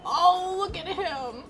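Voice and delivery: high, drawn-out "ohh" of adoration; loud swoop up, melting at cuteness; gushing coo. Real, not acted. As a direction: squeal over something adorable. Reader coos over the crow.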